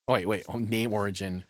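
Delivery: normal talking voice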